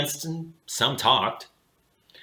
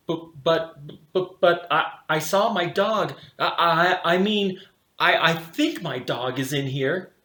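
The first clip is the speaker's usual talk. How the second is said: higher, flustered stuttering; nervous stammer; 5 semitones higher than usual. A narrator's reading. Boy stammering to the bulldog guards, nervous and high. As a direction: trip over the words, caught off guard.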